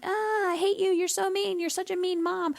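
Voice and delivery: put-on high whining kid voice; mock angry son. Son yelling at his mom, high mock whine. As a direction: whine like an upset child. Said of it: "like my son, going".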